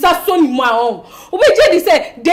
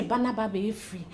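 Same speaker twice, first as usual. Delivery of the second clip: hushed suspicion; 5 semitones lower, 14 dB quieter than usual. Acted. Confiding suspicion about Dave's wife.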